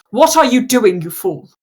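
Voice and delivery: ordinary talk, nothing marked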